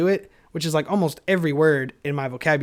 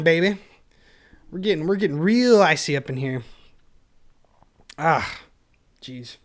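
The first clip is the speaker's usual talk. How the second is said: usual speaking voice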